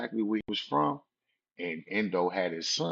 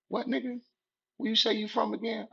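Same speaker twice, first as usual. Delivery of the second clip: much higher menacing demand; mock threatening challenge. Girl in car pressing him again, high menacing demand. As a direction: press him like a gang member.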